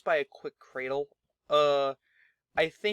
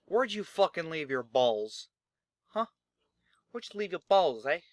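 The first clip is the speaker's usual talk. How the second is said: louder mocking jab; taunting; 3 dB louder than usual. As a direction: needle him with scorn. Cage taunts retired Averno.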